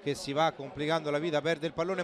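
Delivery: ordinary talk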